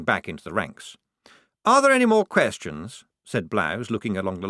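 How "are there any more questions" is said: high, loud officer voice; brisk lieutenant. A narrator's reading. Lieutenant addressing the line of recruits, officiously. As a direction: ask the ranks in a chirpy officer's voice.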